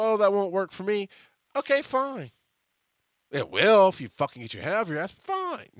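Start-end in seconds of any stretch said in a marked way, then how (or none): 0.0-2.3 high, loud sing-song okay fine; mock shrug. Answering people who reject his idea, airy mock shrug. 5.1-5.7 very high dismissive fine; mock fine. Shrugging off stubborn people, dismissive.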